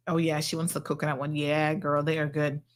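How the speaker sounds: ordinary talk, nothing marked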